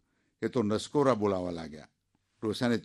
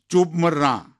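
ચૂપ મર barked louder and higher; hammed-up old husband yelling ચૂપ મર; furious shout; 5 semitones higher, 7 dB louder, slower than usual. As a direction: shout her down for good. Husband silences wife angrily.

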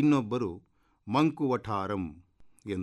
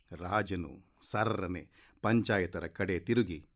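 ordinary delivery, nothing marked

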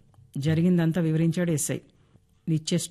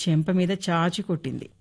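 ordinary delivery, nothing marked

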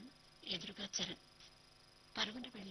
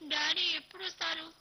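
put-on higher, louder little girl pleading; a small child begging for her father; plaintive child's voice; 9 semitones higher, 11 dB louder than usual. A narrator's reading. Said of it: "దీనంగా అడుగుతోంది సిరి".

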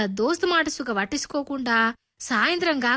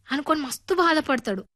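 plain reading voice

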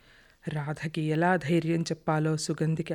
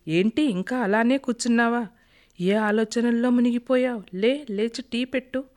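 higher, louder call to rouse her; husband calling out; 5 semitones higher, 4 dB louder than usual. Acted. Husband calls lost-in-thought wife for tea, loudly. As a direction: snap her out of her daydream.

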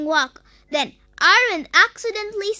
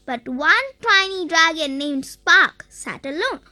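usual speaking voice

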